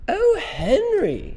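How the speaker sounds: very high, loud, drawn-out plea; crying out. A narrator's reading. Missus Brown appeals to Henry, crying out. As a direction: wail to your husband in a high lady's voice. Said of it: "she cried".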